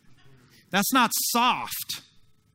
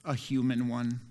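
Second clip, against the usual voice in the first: much lower and much softer, calm and kind; Jesus, gentle; 8 semitones lower, 8 dB quieter than usual. Retold or imitated. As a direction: answer low and quiet, like a patient Jesus. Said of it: "He's like".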